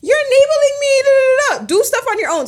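high, loud mocking whine; mocking sister. Speaker mocking the sister's words in a high, loud whine. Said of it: "she said".

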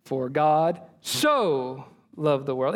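slow right down and hang on the word "so"; stress on SO. Shown as a demonstration. Professor emphasizing word after word, stress on "so". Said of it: "he just emphasized word after word".

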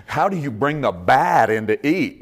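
ordinary delivery

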